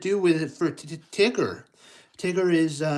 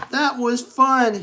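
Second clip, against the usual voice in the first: high, louder, slow squeal of delight; 9 semitones higher, 7 dB louder, slower than usual. Acted. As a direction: squeal with joy after the jump. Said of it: "he squealed".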